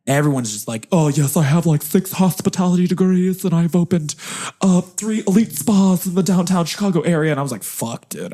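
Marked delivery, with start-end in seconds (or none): 0.9-7.3 higher-pitched parody of a boaster; mock bragging. Mocking fellow applicants at the icebreaker, bragging. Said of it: "opportunity for everyone to start bragging". 7.6-8.3 low, soft curse to oneself; hushed inner dismay. Retold or imitated. Young applicant to himself after others brag, hushed dismay.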